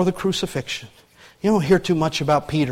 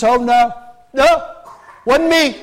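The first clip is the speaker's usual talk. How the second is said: higher, louder nervous brush-off; flustered denial; 5 semitones higher, 3 dB louder than usual. Acted. Peter flustered, denying to the servant, hurried brush-off. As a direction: wave off the accusation in a hurry.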